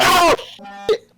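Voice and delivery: sudden high frightened yelp; startled scream. Real, not acted. Jump scare in horror game.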